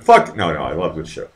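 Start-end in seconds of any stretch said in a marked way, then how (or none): 0.0-0.3 high loud mock curse; mock exasperated curse. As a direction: spit it out fed up. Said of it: "I'm like".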